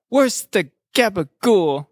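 a hint of a slow mobster drawl on the catchphrase; Tony Soprano voice. Friends naming a fight move, lightly voicing a mobster. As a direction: lean into a light Jersey mobster.

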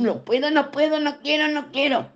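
high, whiny refusal of the Hermit; a childish "no puedo, no quiero"; stubborn Hermit voice. Acted.

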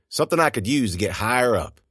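plain reading voice

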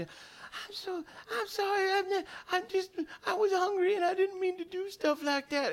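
high, small whimpering cat voice; remorseful cat. Acted. Ashamed cat apologising to its angry owner, high whimper. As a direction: plead tearfully in a tiny high voice. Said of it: "she feels really bad".

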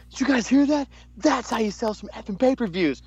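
high, excited backstage boast; hyped Taker impression. Taker praising the promo to the room, high and excited. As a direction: crow it like a hyped-up veteran. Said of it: "he's like".

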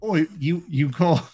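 put-on high-pitched, halting accent parody; mock British-Australian accent. Polar bear challenging a foe, in a high mock British-Australian accent. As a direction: pitch it up like a cocky brawler. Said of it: "I like that he's Australian more".

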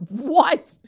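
high, rising incredulous cry; laugh, then squeak it out in disbelief; stretched high-pitched astonishment. Real, not acted.

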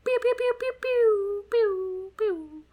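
high pews dropping down; falling laser pews. Pretend. Sound effects.

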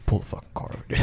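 imitated furious back-seat whisper; seething whisper. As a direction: whisper the order as the fuming friend.

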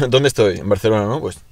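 ordinary delivery

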